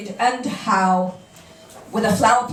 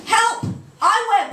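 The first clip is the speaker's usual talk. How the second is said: mock cry for help; 9 semitones higher than usual. Mock panic at the form.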